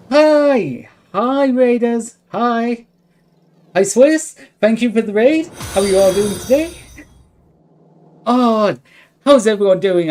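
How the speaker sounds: high, bright excited greeting; cute anime streamer. Real, not acted. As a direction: greet the raiders with bubbly excitement. Greeting incoming raiders excitedly.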